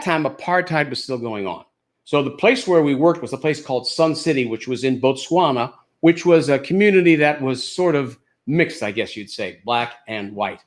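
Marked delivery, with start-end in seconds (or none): none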